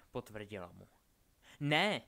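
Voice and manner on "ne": loud, sharp protest; cutting in. A narrator's reading. Albus stops Delphi as she threatens his friend, loud protest. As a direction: burst out with a loud objection. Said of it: "zarazili Albus".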